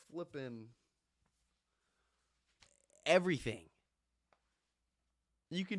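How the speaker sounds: rally the viewers; fired up. Real, not acted. Fired-up pep talk.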